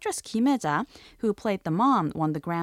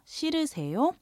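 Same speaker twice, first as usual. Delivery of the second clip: 자연스럽게 빠르게; quicker question; 8 semitones higher, much faster than usual. Shown as a demonstration. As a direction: repeat 싫으세요 at natural pace. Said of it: "two different ways".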